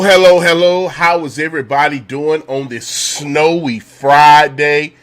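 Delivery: ordinary talk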